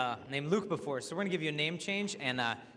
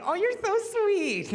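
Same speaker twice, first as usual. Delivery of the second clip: put-on high, slow, sugary girl voice; mock girlfriend cooing; 7 dB louder, slower than usual. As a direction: squeal it like a smitten girl. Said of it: "she's like".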